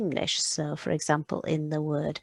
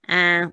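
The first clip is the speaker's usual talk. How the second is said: short nasal French "an"; angry goose honk; 6 dB louder than usual. Shown as a demonstration. Teacher demonstrates French I N as goose, angry honk. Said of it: "make a sound like the goose is angry".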